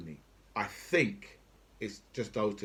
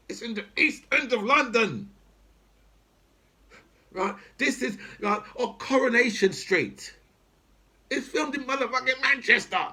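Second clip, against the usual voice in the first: exasperated angry rant; 9 semitones higher than usual. Real, not acted. Furious rant about culture wars.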